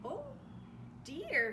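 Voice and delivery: frightened at the rising water; alarmed cry. Acted. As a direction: gasp it out in sudden fright. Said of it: "he cried".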